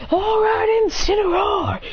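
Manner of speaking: high shouted command; rally the Pokemon with a big shout; trainer's battle shout. Acted. Kukui shouting to Incineroar before the final attack. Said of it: "He says".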